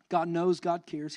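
normal talking voice

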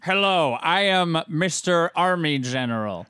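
loud, slow pompous fake general; fake army general. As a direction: play a kid posing as a general. Kid pretending to be general.

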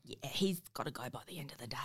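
hushed secret whisper. Acted. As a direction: lean in and whisper it. Late breaking news, hushed.

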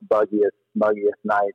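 ordinary talk, nothing marked

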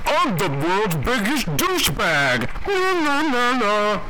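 the President trying out a put-on voice; Hot Toddy impression. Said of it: "try to figure out my Hot Toddy impression".

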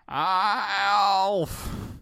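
high, loud wail of Alf's name; dismayed cry. Acted. Willie finding the broken vase, loud wail.

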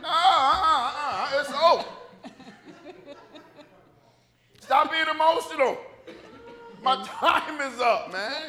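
high, loud comic insistence; hammed-up, drawn-out refusal; mock emotional protest. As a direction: overrule them at full volume. Acted protest against being kept alive.

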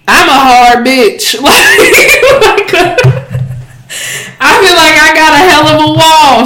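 laughing and bragging; laughing boast. Real, not acted. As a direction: laugh through the brag. Laughing about being guarded.

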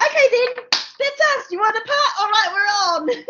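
high, bouncy retold okay; mock cheery. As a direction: chirp it out brightly.

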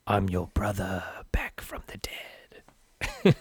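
breathy whispered ghost greeting; spooky ghost voice. Retold or imitated. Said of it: "he's doing that spooky voice".

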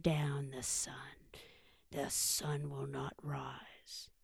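low, hushed, slow intoning; will the sun down under your breath; menacing bear. Acted.

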